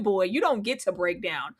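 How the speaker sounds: normal talking voice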